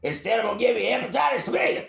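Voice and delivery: high-pitched trainer holler; mock boxing trainer. Trainer Mickey threatening Rocky, high mock holler. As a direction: carry on the hammed-up coach bit.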